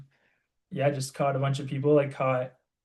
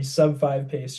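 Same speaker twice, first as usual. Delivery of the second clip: usual speaking voice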